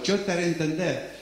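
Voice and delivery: hushed divine promise; voice of God. Acted. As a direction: reassure quietly as God. Pero dice Dios.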